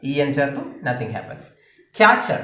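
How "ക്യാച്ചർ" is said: Catcher opened high on its first syllable; first-syllable stress. Shown as a demonstration. Teacher modelling stress, first syllable of catcher high. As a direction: lean on the start of catcher. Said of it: "first syllable ആണ് stress".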